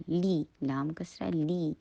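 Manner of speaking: لی with a clear "ee"; slow, clean "lee" on لی; clear ee sound. Shown as a demonstration. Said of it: "کسرہ کے لیے ای کی sound".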